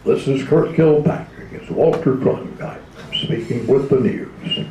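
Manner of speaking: slow, loud anchorman sign-on; weighty news anchor impression, stretching the words; newscaster Walter Cronkite. Radio hopeful auditioning with a news sign-on, anchorman impression. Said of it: "I always wanted to do".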